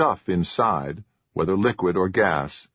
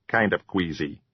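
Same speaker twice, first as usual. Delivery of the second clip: queasy troll; 2 semitones lower than usual. A narrator's reading. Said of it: "Jocko said".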